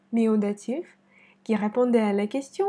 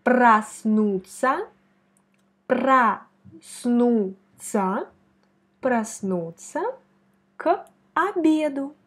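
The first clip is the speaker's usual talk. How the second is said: проснуться said very slowly, syllable by syllable, louder; slow careful demo; 4 dB louder, much slower than usual. Shown as a demonstration. Teacher slowly models the word for student to repeat. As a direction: stretch out проснуться like a dictation. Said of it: "Ce qui nous donne".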